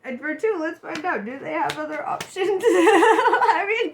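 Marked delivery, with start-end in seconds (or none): 2.7-3.9 higher, louder laugh through the words. Real, not acted. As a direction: break up laughing on it. Bursts out laughing.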